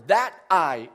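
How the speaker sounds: loud, pointed "That 'I'"; single out "I" with a loud punch; firm stress on "I"; emphatic I. Shown as a demonstration. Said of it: "is emphatic".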